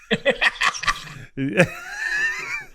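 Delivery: wild high cackle; shrieking laugh. Real, not acted. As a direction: burst out in a howling laugh.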